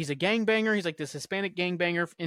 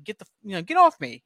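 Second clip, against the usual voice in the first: building up to a loud higher snap of get off me; mild retold shout; 4 semitones higher, 6 dB louder than usual. Guy in the police wagon yelling at his sick buddy, retold shout. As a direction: retell the guy pushing him off, raised.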